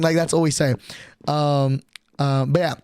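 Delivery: normal talking voice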